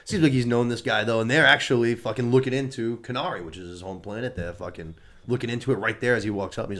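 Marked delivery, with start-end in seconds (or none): none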